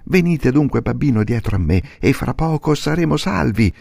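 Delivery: molto alto, incalzante; burattino eccitato. Acted. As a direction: esorta il babbo. Pinocchio guida il babbo. (burattino che esorta il babbo, voce alta)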